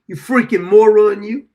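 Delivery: a sudden yelled insult; scornful shout; loud insult. Real, not acted. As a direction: bark the insult at him. Insulting a donor on air.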